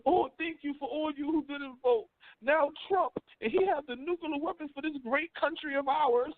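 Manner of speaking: mocking whiny voice. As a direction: parody of the upset so-called woke crowd. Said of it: "Now they running around talking about".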